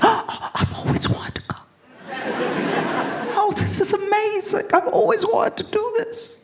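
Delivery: very high, airy gushing; mock awestruck, high and whispery; imitating excited girlfriend. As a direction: gush in a hammed-up girlfriend voice. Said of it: "then she's all excited".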